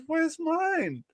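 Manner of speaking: high, soft squeaky demand; mock indignant squeak. Teller retelling demanding his share, high mock squeak. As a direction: squeak it like a jealous kid. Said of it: "I said".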